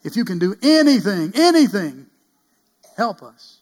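loud, high pleading cry; desperate father's loud plea. Acted. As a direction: beg loudly, voice up high. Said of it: "His father cried out".

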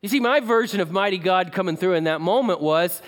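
normal talking voice

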